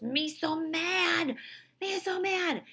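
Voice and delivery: high, loud imitation of a furious two-year-old; mad toddler. Mom imitating her two-year-old's big feelings, mock toddler fury. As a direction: squeal it slowly like a cross little kid. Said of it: "squeezes her fist and scrunches her face".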